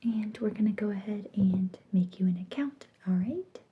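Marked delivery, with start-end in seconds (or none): none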